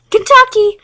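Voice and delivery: loud high shout; scare yell. Acted. Charlie sneaking up behind Claire, loud scare shout. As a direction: jump out and yell it.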